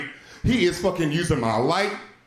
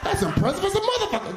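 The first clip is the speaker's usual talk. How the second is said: much higher, laughing mock admiration; dad oddly impressed by his son, high and laughing; amazed. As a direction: marvel in a squeaky, impressed voice. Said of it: "I'm like".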